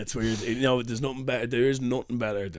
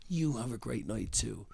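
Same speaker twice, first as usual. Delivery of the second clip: slow, much softer well-wishing; soft sincere reply; 11 dB quieter, slower than usual. Retold or imitated. Flyerer answering a kind passer-by, soft and sincere. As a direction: wish them well, hushed and unhurried.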